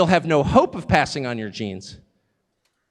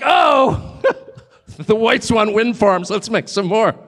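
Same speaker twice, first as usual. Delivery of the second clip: mocking laugh; 3 semitones higher, 3 dB louder, slower than usual. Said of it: "They're like".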